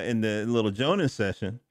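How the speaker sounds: ordinary talk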